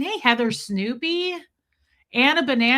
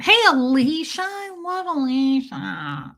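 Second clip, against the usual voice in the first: gushing greeting; 4 semitones higher, 3 dB louder, slower than usual. Real, not acted. Gushing over a livestream viewer.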